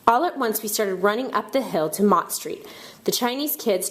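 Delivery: ordinary delivery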